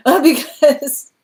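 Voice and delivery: high, loud laugh through the words; real laughter slowing the words right down. Real, not acted. Reader explaining the tears of joy, laughing.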